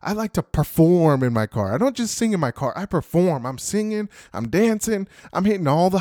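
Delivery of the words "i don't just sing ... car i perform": ordinary talk, nothing marked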